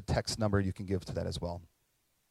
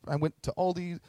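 normal talking voice

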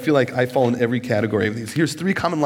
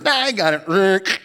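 cocky brush-off; 5 semitones higher, slower than usual. Pretend. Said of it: "It's like".

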